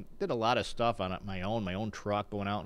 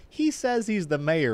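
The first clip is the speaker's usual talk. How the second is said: raised, slow, incredulous announcement to a friend; calling to friend; 9 semitones higher, 8 dB louder, slower than usual. Retold or imitated. Race guard telling her friend about the mayor, mocking call-out. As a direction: call it out higher and louder, drawn out.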